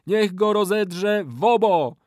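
slow, high, loud wail; ritual curse. A narrator's reading. Old man calls beasts on betrayer, wailing incantation. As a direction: cry out the incantation, drawn out.

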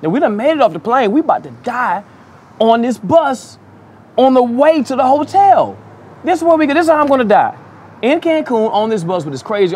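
high, rising panic, voice climbing; we're sure we're about to die on this bus; panicked. Retold or imitated. To his friends on the wild bus ride, panicked and high. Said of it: "We looking at each other like".

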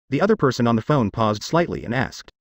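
ordinary delivery, nothing marked

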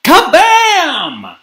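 high, booming sound effect; loud crash exclamation. A narrator's reading. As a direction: burst out with a loud bang word. Narrator voices a crashing noise.

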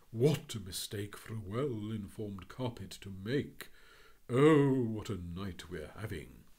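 slow, loud, odd bird voice; lofty bird correcting everyone; pompous Phoenix. Acted. Phoenix lecturing calmly.

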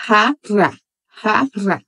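jarra with a rasped throaty J; Spanish pronunciation. Shown as a demonstration. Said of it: "jarra, em espanhol, seria".

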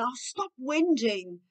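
slow, drawn-out exasperation; fed-up scolding. Shown as a demonstration. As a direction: drag it out, fed up. Models fed-up parent. (pai ou mãe sem paciência)

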